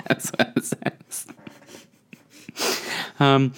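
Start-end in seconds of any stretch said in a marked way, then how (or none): 0.1-1.3 unvoiced, slow whisper; whispered slow remark; whispered aside. Real, not acted. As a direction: drop to a whisper to excuse it. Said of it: "whispered aside".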